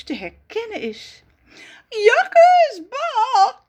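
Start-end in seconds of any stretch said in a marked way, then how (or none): 1.9-3.6 high, loud whining yuck; whimpering disgust. Acted. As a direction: wail it, covered in cherry juice. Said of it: "kermt ze". (kermend, vies en hoog)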